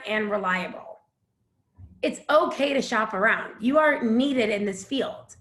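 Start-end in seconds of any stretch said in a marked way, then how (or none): none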